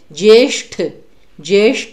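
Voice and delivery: ज्येष्ठ with the tongue curled on ष; careful ष in ज्येष्ठ; retroflex ष in ज्येष्ठ. Shown as a demonstration. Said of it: "जीभ को मोड़ना".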